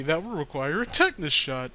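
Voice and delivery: usual speaking voice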